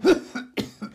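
hammed-up coughing fit; acted coughing. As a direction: cough as if in dust under the bed. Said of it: "begann sofort kräftig zu husten".

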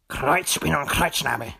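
fox grumbling a mild curse. A narrator's reading. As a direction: grumble it to yourself. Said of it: "murrte er". (vor sich hin murrend)

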